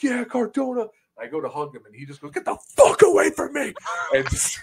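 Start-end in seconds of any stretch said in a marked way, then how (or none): 0.0-0.9 excited fan cheer. Retold or imitated. Fan running up to hug his favourite wrestler, excited. 2.3-3.7 high, loud imitated yell; angry rebuff. Wrestler refusing a fan's hug, angry imitated shout.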